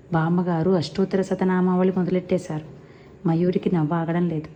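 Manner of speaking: ordinary delivery, nothing marked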